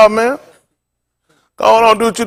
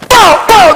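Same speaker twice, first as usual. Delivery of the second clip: mock popping noise; 9 semitones higher, 3 dB louder than usual. Imitating pencil-busting noise.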